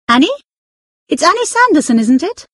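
high, sharp woman's voice calling out. Acted. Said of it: "A woman's sharp voice".

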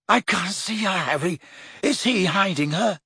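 lightly voiced lovesick moan. A narrator's reading. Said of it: "Ron chose this moment to moan".

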